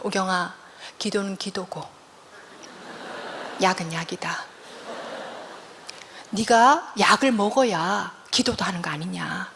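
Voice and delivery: lightly voiced father, low and quiet; a hint of a measured, admonishing father; father's voice. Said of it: "저희 아버지는". (낮고 조용히 타이르는 아버지 흉내)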